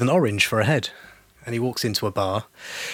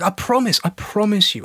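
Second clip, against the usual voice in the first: high, earnest pleading protest; pleading insistence; 9 semitones higher than usual. Acted. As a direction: insist desperately that it's true. Guy insists his story is true.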